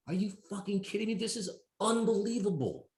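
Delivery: high, fast, breathless amazement; ecstatic disbelief. Retold or imitated. Counselor voicing the joy of inner work, ecstatic and fast. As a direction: gush like you can't believe it.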